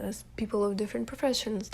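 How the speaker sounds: ordinary talk, nothing marked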